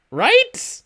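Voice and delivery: disbelieving, demanding Right; incredulous right. Real, not acted. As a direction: pitch Right way up and push it. Insistent repeat of the question.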